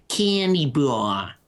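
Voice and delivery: cartoonish, drawn-out American accent; attempted American accent. Naming what they call it over there, hammed-up American accent. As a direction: overdo the American vowels, stretched out. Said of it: "a pretty good attempt at an American accent".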